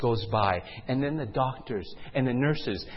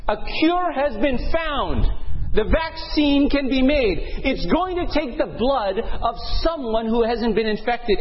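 raised, driving narrator voice; urgent dramatic storytelling; 9 semitones higher, 4 dB louder than usual. Acted. Storyteller announces a cure to the nation, urgent and proclaiming. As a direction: proclaim it like an emergency broadcast.

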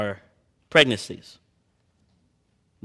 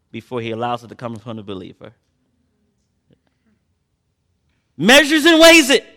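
fervent preaching; 9 semitones higher, 7 dB louder than usual. Acted. Preacher urging his congregation, in a raised preaching voice.

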